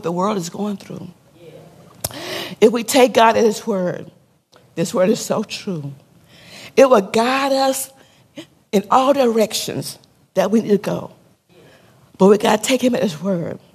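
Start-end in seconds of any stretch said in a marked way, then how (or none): none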